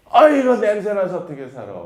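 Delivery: put-on teen complains to parents about the smell; loud whining complaint. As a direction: protest the stink loudly.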